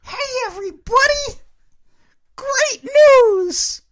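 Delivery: put-on very high, squealing little kid voice; excited kid on Christmas. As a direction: gush like a kid on Christmas morning. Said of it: "can only do it justice by doing it in a excited little kid on Christmas morning voice".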